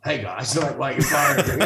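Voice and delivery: low, offhand question with a chuckle; lightly amused, low-pitched imagined confrontation; mock casual query. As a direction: ask it casually, as if it's no big deal. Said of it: "I didn't really go to Channel seven and go".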